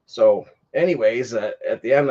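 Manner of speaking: normal talking voice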